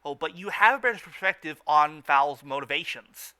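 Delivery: ordinary delivery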